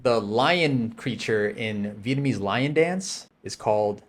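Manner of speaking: ordinary talk, nothing marked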